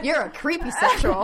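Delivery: high, loud laughing tease; giggly jab. Real, not acted. Teasing friend.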